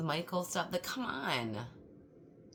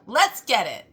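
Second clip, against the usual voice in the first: excited shout; 7 semitones higher, 18 dB louder, slower than usual. Real, not acted. Hyped sign-off to viewers.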